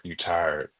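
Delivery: normal talking voice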